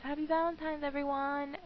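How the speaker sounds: high, cheery holiday wish; cheery greeting. Real, not acted. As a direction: pitch up, greeting all the viewers.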